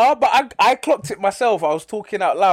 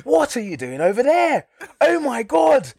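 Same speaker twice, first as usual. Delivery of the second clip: hammed-up dub voice; 9 semitones higher, slower than usual. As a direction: ham it up like a bad dub. Mocking the English dub acting.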